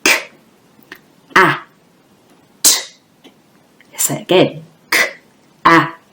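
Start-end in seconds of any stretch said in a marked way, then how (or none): 0.0-2.9 K, a, t, said as single paced sounds; separate sounds. Shown as a demonstration. Teacher sounding out K, a, t for children. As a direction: say each sound apart with pauses. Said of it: "Let's try and read it together".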